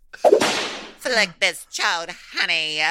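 high, slow, loud sassy drawl; sassy drag diva. Pretend. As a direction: serve it like a drag queen. Repeating line as camp diva.

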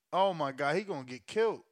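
ordinary delivery

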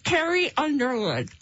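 name mangled by a full mouth. Real, not acted. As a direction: cheeks stuffed with marshmallows. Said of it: "That was clear as day".

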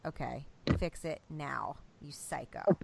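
quiet inner voice talking sense to oneself; self-scolding. Retold or imitated. Mom to herself after yelling, hushed stern self-talk. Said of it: "outer body experience and saw the site".

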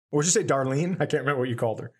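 normal talking voice

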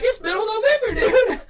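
high, loud squealing plea; hammed-up distraught wail; panicked protest. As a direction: whine it in comic falsetto disbelief. Acts out protest at the wait.

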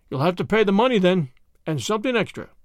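plain reading voice

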